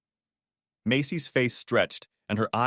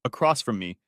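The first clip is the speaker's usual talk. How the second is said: ordinary delivery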